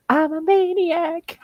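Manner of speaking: high, slow mock scream. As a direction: shriek it like a maniac. Retelling Echo screaming while sprayed.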